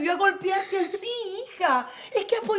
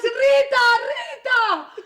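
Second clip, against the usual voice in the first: higher, louder call to the maid; 5 semitones higher, 6 dB louder, much slower than usual. Acted. Mother calls for the maid in alarm, shouting. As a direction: shout across the house for help.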